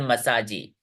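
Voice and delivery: मसाजी with an added "ee" at the end; sounded final E. Shown as a demonstration. Teacher showing what we don't say, मसाजी with final ee. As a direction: end मसाजी on a voiced JEE. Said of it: "last वाला ई silent है, हम लोग नहीं बोलते हैं".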